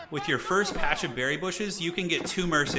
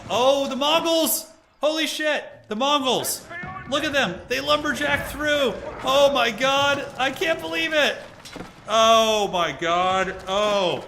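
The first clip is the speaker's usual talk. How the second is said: ecstatic shouting, much higher and louder; dawning joy; 10 semitones higher, 6 dB louder, slower than usual. Real, not acted. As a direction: yell it as the play lands. Caster sees surprise breakthrough.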